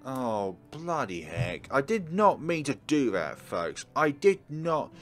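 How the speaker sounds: ordinary talk, nothing marked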